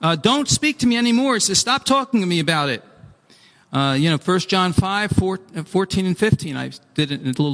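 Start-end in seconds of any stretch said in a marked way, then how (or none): 0.2-1.3 higher, acted stern rebuff; angry refusal. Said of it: "the Lord was angry with me". 1.6-2.8 quick, mildly cross dismissal; angry refusal. Acted. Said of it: "the Lord was angry with me".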